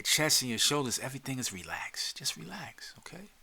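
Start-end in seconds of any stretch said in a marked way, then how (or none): 1.0-3.3 whisper. Acted. Soothing relaxation guide.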